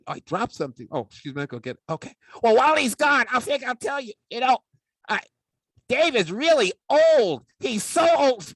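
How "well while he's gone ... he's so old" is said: high and loud teasing puppet voice; cheeky puppet. Acted. Puppet sidekick mocks Dave's age to the audience, high and loud. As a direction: tease the old man, much higher and louder.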